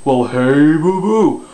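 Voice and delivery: big dopey false-voice hail; Yogi Bear. Shown as a demonstration. Coach setting up a false chord growl, Yogi Bear voice. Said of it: "while you're in the Yogi Bear".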